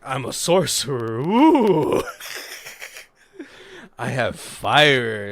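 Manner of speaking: hammed-up, loud showman boast; mock sorcerer. Jamal boasting to the crowd at his execution, hammed-up. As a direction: play a fake wizard, big and slow.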